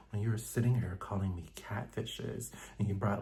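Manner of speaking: soft, hushed prim imitation; Angel's soft voice. Retelling the show, imitating Angel's soft prim voice. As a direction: mimic her quiet, proper tone. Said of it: "Y'all know how she talks".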